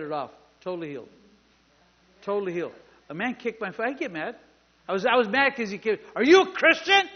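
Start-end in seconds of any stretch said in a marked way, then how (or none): 6.1-7.1 high, loud, badgering question; infuriating demand. Healer interrogating a man in a cast, acted yelled demand. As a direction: shout the question as the pushy healer. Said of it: "that, that, that infuriated me".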